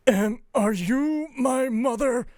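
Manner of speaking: high, slow, lost little voice; a bewildered baby item asking; squeaky newborn item. Acted.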